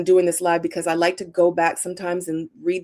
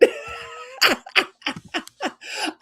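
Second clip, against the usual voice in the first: a high, airy, breathless laugh; 14 semitones higher, 3 dB quieter than usual. Real, not acted. Reacting to her own read, breathless laughter. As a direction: lose it silently.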